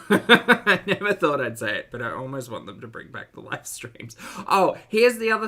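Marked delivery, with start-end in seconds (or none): 0.1-1.9 laughing through the words, louder; giggling as you repeat it. Real, not acted. Laughing while repeating it to chat.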